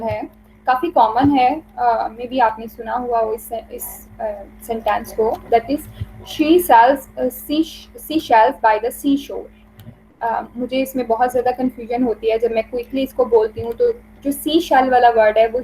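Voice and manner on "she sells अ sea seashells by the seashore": slow, careful seashells; slow tongue twister. Shown as a demonstration. Teacher showing students the tongue twister, slowly. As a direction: lay each word out slowly.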